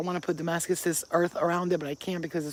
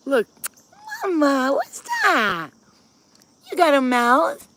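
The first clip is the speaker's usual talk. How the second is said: high-pitched fussing over a pet; 6 semitones higher, 6 dB louder, slower than usual. Real, not acted. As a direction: coo excitedly at a cat. Baby-talking to the cat.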